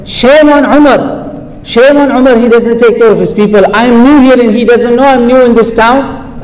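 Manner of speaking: a mother fed up, calling out Umar; indignant complaint. Retold or imitated.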